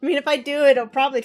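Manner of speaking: laughing. Real, not acted. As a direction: speak through the giggles. Laughing while talking.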